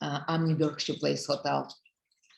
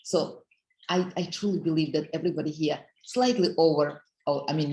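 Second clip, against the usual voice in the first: ordinary talk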